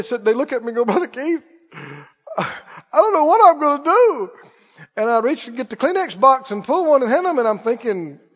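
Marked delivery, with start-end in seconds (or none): 0.8-4.3 higher, imitated tearful plea; mock crying. Troubled caller confiding in the young prayer worker, mock crying. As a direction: play up someone helpless and in tears. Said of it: "and they start crying".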